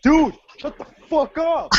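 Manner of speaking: high, loud, drawn-out complaint; exasperated retold shout. Telling friends about the noisy breather, exasperated shout. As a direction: yell it as a worked-up retelling.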